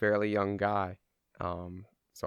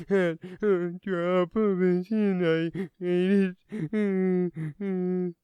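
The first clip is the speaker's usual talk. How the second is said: put-on high, loud, sobbing small-kid voice; acted kid crying fit, words half understood; crying little kid; 10 semitones higher, 6 dB louder, much slower than usual. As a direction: blubber it out like a panicked little boy. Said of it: "best reenactment I could do of a little kid fucking crying".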